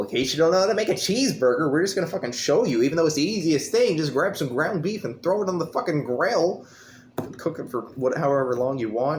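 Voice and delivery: hammed-up how-to narrator; mocking tutorial voice. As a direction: parody a smug instructional voice, rushing. Mocking the movie's cooking scene.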